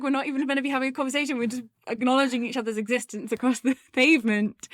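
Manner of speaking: high, loud, breaking into laughter; helplessly stuck, laughing through the words; mock panic. Acting out being stuck in greetings.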